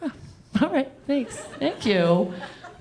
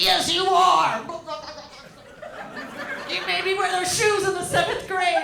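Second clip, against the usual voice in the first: insistent retort; 10 semitones higher than usual. Retold or imitated. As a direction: drag out a spiteful told-you-so. Insisting to drowning mother.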